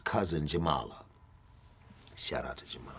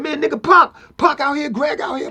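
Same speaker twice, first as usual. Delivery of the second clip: high, loud comic imitation of a caller; 7 dB louder than usual. Friend calling [name] to ask what's up, comic loud imitation. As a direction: demand answers down the phone, high and loud.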